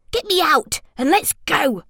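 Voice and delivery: high, panicky squeak. A narrator's reading. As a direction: squeak it out, desperate to go. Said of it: "Mo squeaked".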